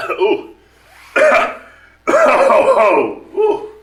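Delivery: big booming ho-ho laugh into a cough; jolly belly laugh. Acted. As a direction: laugh loud and high, then cough it off. Santa fakes a coughing fit.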